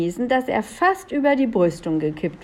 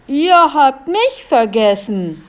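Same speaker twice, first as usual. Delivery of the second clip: slow, loud, higher yell; 5 semitones higher, 6 dB louder, slower than usual. A narrator's reading. Invisible villain yells at the escapers, shouting. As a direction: yell it out, stretching the words. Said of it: "schrie der Unsichtbare".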